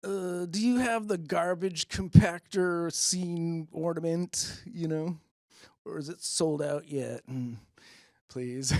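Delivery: nerdy pleading fan. Retold or imitated. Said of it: "I'm like".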